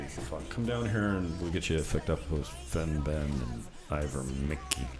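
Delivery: usual speaking voice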